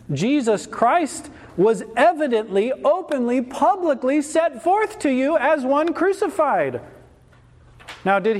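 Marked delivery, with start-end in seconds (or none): 0.1-6.8 raised-pitch, proclaiming sermon voice; emphatic preaching. Real, not acted. Preacher reminding converts of Christ crucified, emphatic preaching. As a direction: proclaim each word to the congregation.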